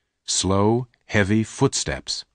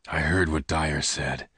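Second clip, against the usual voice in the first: lower, softer, held back; low pained gunman; 4 semitones lower, 5 dB quieter than usual. Acted. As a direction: push her away quietly. Lassiter hurt.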